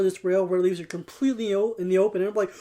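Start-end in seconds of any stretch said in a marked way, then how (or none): none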